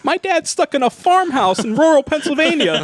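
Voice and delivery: put-on high, loud kid voice; whiny kid voice. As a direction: whine back at the coach. Kid answering the coach.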